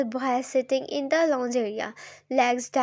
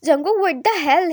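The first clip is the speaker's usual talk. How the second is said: louder furious demand; 3 semitones higher, 6 dB louder than usual. A narrator's reading. Mother confronts Jungkook over her son, furious. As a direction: confront him hard. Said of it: "You turned furious".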